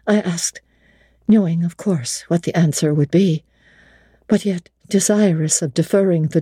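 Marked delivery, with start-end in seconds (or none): none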